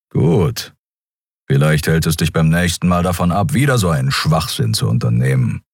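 stern Skria. Acted. Kero, unmoved.

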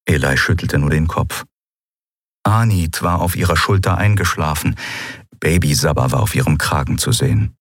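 ordinary delivery, nothing marked

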